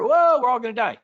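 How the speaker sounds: high, loud doom-crying wail; hammed-up market panic; mock panic. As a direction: scream like the sky is falling. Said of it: "what happens after".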